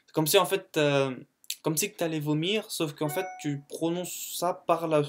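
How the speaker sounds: ordinary talk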